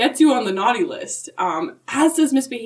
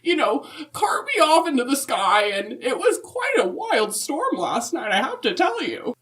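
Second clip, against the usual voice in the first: put-on higher, sweet old lady voice; Mrs Claus voice; 4 semitones higher than usual. Answering the podcast hosts as Mrs Claus, higher and sweet. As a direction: play a chirpy grandmotherly Mrs Claus.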